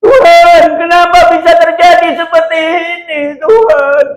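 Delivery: high, loud anguished cry to God. Retold or imitated. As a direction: wail out the question, near tears. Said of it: "Dia nangis". (meratap keras dengan suara tinggi)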